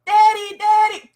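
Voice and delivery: put-on high, loud child calling daddy; excited kid. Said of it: "the kids might come over and they're like".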